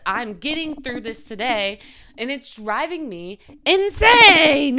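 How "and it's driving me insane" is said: loud, slow exasperated cry; frustrated outburst. Real, not acted. Telling viewers her struggle, a loud exasperated outburst. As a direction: push the frustration out hard. Venting frustration about getting through.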